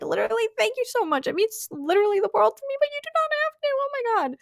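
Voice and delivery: high, squealing gratitude; gushing thanks, voice climbing up high; flustered, thrilled thank-you; overwhelmed thanks. Real, not acted. Overwhelmed by donations.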